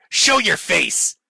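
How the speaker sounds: high, emphatic mock demand. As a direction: blurt it high, mocking an indignant heckler. Mimics the demand to show face.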